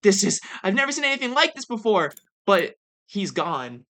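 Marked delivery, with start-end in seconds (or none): 0.0-2.1 mock astonished outburst; freaking out. Survivors reporting back on what they saw, freaking out. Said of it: "they're, like, freaking out".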